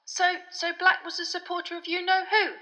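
high, hesitant, apologetic question; apologetic prompt. A narrator's reading. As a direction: nudge gingerly after a blunder. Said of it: "Harry prompted apologetically".

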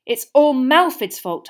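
high, loud, slow insistence; earnest. A narrator's reading. Hermione comforts Hagrid, earnest. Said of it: "said Hermione earnestly".

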